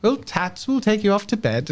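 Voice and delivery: Harold's voice. Acted. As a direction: Harold tells Medela she is going to bed, high and bright. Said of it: "Harold says".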